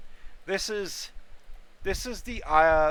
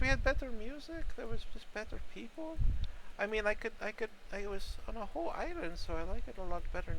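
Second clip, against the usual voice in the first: put-on old mushroom voice; 5 semitones higher, 4 dB quieter than usual. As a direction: elderly voice. Elderly mushroom NPC complains.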